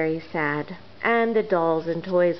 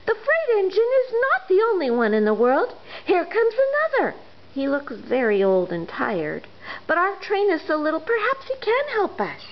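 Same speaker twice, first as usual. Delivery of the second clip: much higher eager clown voice; hopeful clown; 11 semitones higher than usual. Acted. Clown tells toys another engine comes, hopeful. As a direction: spot rescue and hope.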